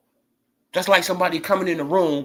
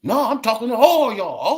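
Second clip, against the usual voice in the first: higher, louder cocky answer; defiant reply; 6 semitones higher, 6 dB louder than usual. Acted. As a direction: throw it back at the whole room.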